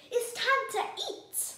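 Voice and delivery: loud, high and drawn out; slow, ringing declaration; excited announcement. A narrator's reading. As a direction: proudly proclaim that food is coming. Announces the meal.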